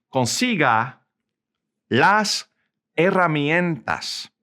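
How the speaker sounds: slow Spanish, a long pause after each word; slow model sentence. Shown as a demonstration. Teacher models the command for learners, slow, word by word. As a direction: break "Consiga las herramientas" into separate, slow words.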